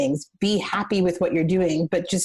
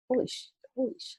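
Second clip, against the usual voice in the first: hushed, rushed awestruck swearing; stunned disbelief; 6 semitones higher, 9 dB quieter, much faster than usual. Retold or imitated. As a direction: breathe it out in shock, fast. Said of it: "And I was like".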